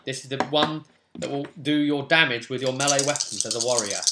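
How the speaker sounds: ordinary delivery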